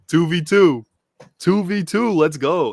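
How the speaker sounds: hyped. Real, not acted. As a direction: cheer for the two v two. Hyped at the matchup.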